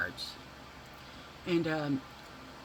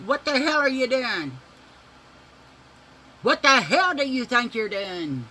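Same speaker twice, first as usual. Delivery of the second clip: imitated angry mother's shout, higher and louder; mom mad as hell; 8 semitones higher, 8 dB louder than usual. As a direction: yell at the kids like a furious mom. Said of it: "She was mad as hell".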